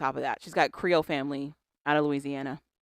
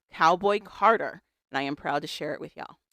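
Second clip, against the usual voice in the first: ordinary talk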